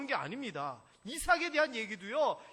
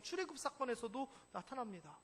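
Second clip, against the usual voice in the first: ordinary talk, nothing marked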